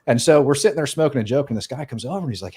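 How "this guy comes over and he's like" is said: ordinary delivery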